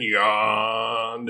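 slow, lingering yawn on YAWNED; drawn-out yawning word. A narrator's reading. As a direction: stretch YAWNED out long and slow. Narrator acts out the yawn word.

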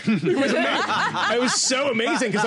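laughing while talking; amused, laughing through words. Real, not acted. Laughs over producer story.

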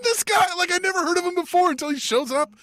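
very high-pitched disbelief; incredulous outburst. Real, not acted. Co-host baffled by the unknown newcomer, incredulous outburst. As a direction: send the voice way up in baffled exasperation.

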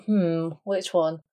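slow, unsure pondering hmm; thinking hmm. Real, not acted. Guessing which fact is false.

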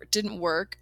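ordinary talk, nothing marked

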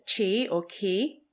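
"qi or qi" said slowly in two variants, like "tsjie"; Chinese pronunciation. Shown as a demonstration. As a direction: pronounce "qi" carefully in the Chinese way, twice. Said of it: "life energy in Chinese is".

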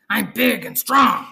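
loud, gruff threat; tough biker guinea pig. Acted. Guinea pig warns the imaginary Fluffy off his food, gruff and loud. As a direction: bark it like a grizzled biker. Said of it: "biker with a pack-a-day habit".

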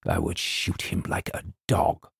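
stern colonel's whispered threat; menacing whisper. Acted. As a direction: breathe the threat quietly.

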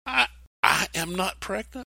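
put-on a bewildered girl objecting to the angel; Mary protesting. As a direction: plead the impossibility with wide-eyed disbelief.

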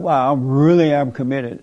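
usual speaking voice

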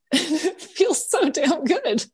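laughing through real tears; crying, worn out. Real, not acted. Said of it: "now I can't stop crying".

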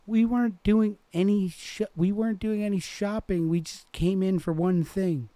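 put-on high, loud girl protesting; piping up. A narrator's reading. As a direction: pipe up high and defensive in a girl's voice. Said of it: "pipes up".

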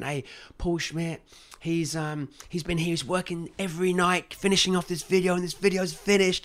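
a hint of a stage presenter. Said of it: "presentation ... and saying".

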